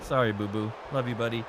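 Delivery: soft, low pet talk; soft apology to the dog; tender apology. Real, not acted. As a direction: gently make it up to the dog.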